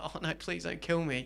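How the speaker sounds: soft, mock-frightened plea; meek pleading. Player begging the biggest prisoner for mercy, meek pleading. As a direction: beg quietly, play-acting fear. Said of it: "like that".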